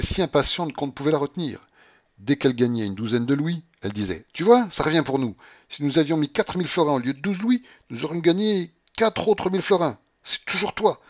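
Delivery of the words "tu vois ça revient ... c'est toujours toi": high, loud gambler's excitement; crow, then blame him, voice raised high; excited reproach. A narrator's reading. Babouchka to Alexis over winnings missed, excited reproach.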